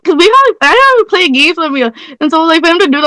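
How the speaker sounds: playful re-enacted fury; angry on request. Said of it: "you need to do the emotion that you had behind it".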